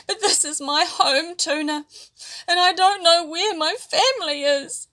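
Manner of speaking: put-on high, tearful child voice; small fish near tears, missing family; crying. A narrator's reading. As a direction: plead through tears. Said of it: "Kahawai began to cry".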